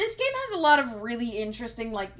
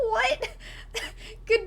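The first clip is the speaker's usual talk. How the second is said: laughing disbelief; 11 semitones higher, 4 dB quieter than usual. Real, not acted. Laughing at a chat message.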